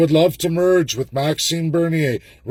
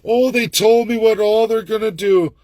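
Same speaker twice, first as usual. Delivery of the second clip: mocking gullible voter; 8 semitones higher, 3 dB louder than usual. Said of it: "But it's so easy to".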